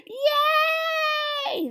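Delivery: high, loud gasp and yay; excited high cheer. A narrator's reading. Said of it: "the crowd cheered".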